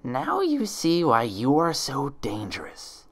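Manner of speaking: slow, menacing hiss; hissed threat. Acted. Impostor leaning over pinned Twilight, slow menacing hiss. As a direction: lean in close and hiss it. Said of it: "it hissed".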